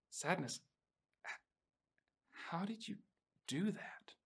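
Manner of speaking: hushed, high, halting disbelief; flabbergasted Joy. Retold or imitated. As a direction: act out stunned amazement, nearly whispering with pauses. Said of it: "completely flabbergasted".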